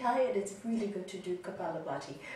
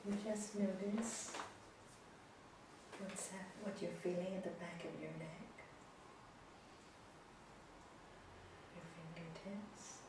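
soft spa whisper; 3 semitones lower, 20 dB quieter than usual. Acted. Guided breathing final rounds.

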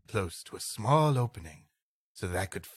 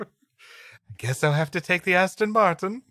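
high, louder mock rich lady whine; 9 semitones higher, 5 dB louder than usual. Co-host mocking the rich lady over her lost car. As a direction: play the spoiled rich woman, put out. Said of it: "she's a rich lady".

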